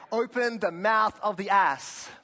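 loud, high and slow congregational lead; mock-solemn, drawn-out verse reading; grand scripture proclamation. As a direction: proclaim it grandly for a crowd to repeat. Mock call to read along.

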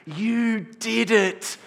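ecstatic drawn-out yell, high and very loud; imitated top-of-the-lungs cheer, each word stretched; dad screaming. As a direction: scream it over and over like an overjoyed sideline dad. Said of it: "Dad screaming at the top of his lungs".

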